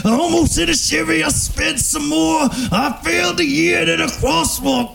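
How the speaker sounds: frantic Jim Carrey rant; manic rant. Acted. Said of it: "a little ditty from, uh, Liar Liar".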